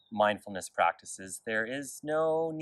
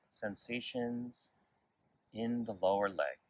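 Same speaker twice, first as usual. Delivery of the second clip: guided meditation; 4 dB quieter than usual. Real, not acted. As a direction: lead gently through the body, unhurried and quiet. Leads a body scan meditation.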